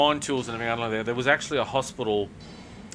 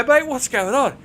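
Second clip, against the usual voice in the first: high, loud call after a mate; urgent puzzled question, pitch raised; baffled; 11 semitones higher, 7 dB louder, slower than usual. Retold or imitated. Calling after colleague storming out.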